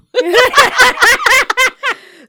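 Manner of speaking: loud high zombie screech; zombie noise. Pretend. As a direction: screech like a zombie at his ear. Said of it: "So I'm making the zombie noise".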